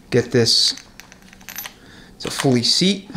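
usual speaking voice

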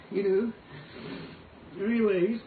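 ordinary delivery

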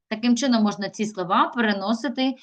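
ordinary talk, nothing marked

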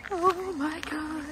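high, drawn-out gasp of awe; overwhelmed excitement. Real, not acted. Fan standing before the film house, overwhelmed.

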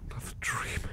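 whisper, half awake and shaken; groggy whisper. Acted. Butler waking from a nightmare, whispering. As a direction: breathe it out just after waking.

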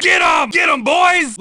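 loud call to attack, pitch up; rally the boys to charge; shouted order. Acted. Gang leader orders attack.

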